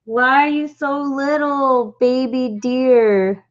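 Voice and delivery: slow, high cooing to a tiny deer. Real, not acted.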